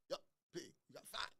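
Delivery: retold whispered time cue; hushed warning. Stagehand whispers to speaker on stage, hushed. Timekeeper signals time during talk.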